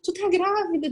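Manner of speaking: high, emotional announcement; imitating tearful husband. Husband telling wife she is pregnant, imitated tearful joy. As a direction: retell a choked-up joyful outburst. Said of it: "como ele chorava".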